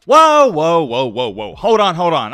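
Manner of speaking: loud, high whoa to halt the crowd; playful stop-everything call; mock alarm. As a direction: throw up the brakes on the viewers. Stopping imagined objections.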